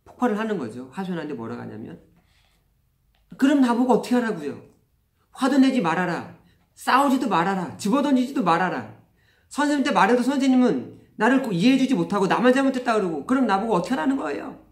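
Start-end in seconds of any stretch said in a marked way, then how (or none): 3.4-14.6 imitating a child's loud, high-pitched protest; acted wail of complaint, louder and higher; tearful outburst. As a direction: pour out pent-up grievances like an upset child. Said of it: "그렇게 막 울면서 하소연을 하는 거예요".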